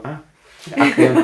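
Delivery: high, sudden startled call; startled realization. Retold or imitated. Director suddenly remembering to call action, high. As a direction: jolt awake and call it. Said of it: "Y yo".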